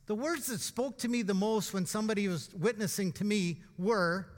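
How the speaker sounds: usual speaking voice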